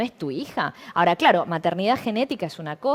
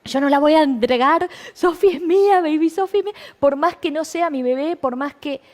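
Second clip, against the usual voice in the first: mock desperate plea, higher and louder; retelling her sister's desperate protest, higher and louder; imitating desperate crying sister; 8 semitones higher, 5 dB louder than usual. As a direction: voice the sister's frantic refusal, raised pitch. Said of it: "mi hermana llorando desesperada, diciendo".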